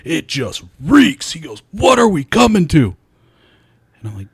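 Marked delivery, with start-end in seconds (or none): 0.0-1.3 high, drawn-out tirade of disgust; hammed-up outrage, slow and high; going nuts. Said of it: "He's going nuts". 1.7-2.9 loud, high, drawn-out outrage; hammed-up indignant cry; going nuts. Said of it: "He's going nuts".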